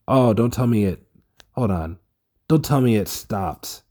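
panicked that something went wrong; alarmed. Real, not acted.

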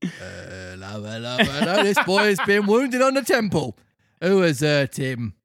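police officer finding a puppet on the ground, bobby voice; Victorian bobby. Acted. Carabinier voice.

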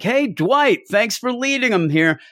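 high, sing-song fake thanks; hammed-up cheerful villagers; mock cheery praise. Mocking characters praising Dwight.

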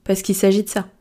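ordinary delivery

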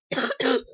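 dry cough; real sick cough; coughing fit. Real, not acted. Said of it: "I'm sorry I keep coughing".

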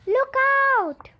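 alarmed warning cry; a startled call of danger; Kirsty warning as the coach swerves; sudden gasp. Acted. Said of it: "gasped suddenly".

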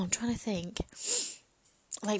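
normal talking voice

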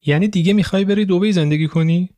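Leila blurts it out, caught off guard; surprised question. Acted. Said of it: "با تعجب پرسید".

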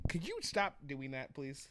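ordinary talk, nothing marked

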